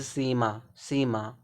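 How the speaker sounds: सीमा slowly, with a long ee in the first syllable; long vowel sound. Shown as a demonstration. Teacher giving an example of long sound, long ee in सीमा. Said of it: "जब long sound देगा".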